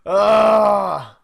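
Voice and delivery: loud exasperated ugh; frustrated groan. Retold or imitated. Dad during daughter's meltdown, loud frustrated groan. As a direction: groan like a worn-out parent. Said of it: "I'm just like".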